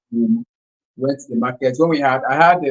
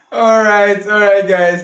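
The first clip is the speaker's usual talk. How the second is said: excited; 7 semitones higher, 5 dB louder, slower than usual. Real, not acted. Host cheers after the game.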